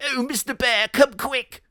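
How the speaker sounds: frantic high-pitched critter. Acted. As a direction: squeal for the bear to hurry. Weasels call the bear.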